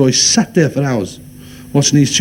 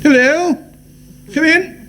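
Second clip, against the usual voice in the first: high sing-out to a visitor; put-on businesslike call, pitched way up; solicitor calling out; 14 semitones higher, slower than usual. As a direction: call them in on a high note. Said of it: "pretending there was somebody on the phone".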